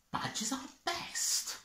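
high, soft, breathy-whispered musing; pondering Beaver. Acted. Beaver reads the sign aloud, softly wondering. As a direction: puzzle it out under your breath. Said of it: "pondered Beaver".

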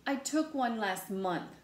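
plain reading voice

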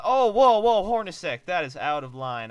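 high loud whoa, scolding; shocked outburst. Real, not acted. Gamer scolding his own player, loud shocked whoa. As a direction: react like your player went rogue.

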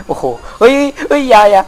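high, loud panicked yelling. Real, not acted. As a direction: yell in fright as the zombie grabs.

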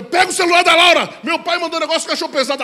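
high, loud mock panic; hammed-up urgent phone call; frantic retold call. Acted frantic call to wife.

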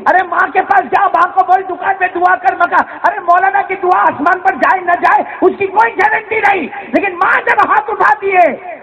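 high, ringing preacher's cry; impassioned preaching. Acted. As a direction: exhort the crowd to go to their mothers. Preacher exhorts the crowd.

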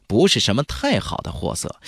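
ordinary delivery, nothing marked

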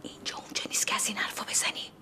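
quick whispered reprimand; fast scolding whisper. Real, not acted. Mother hushing her son at gathering.

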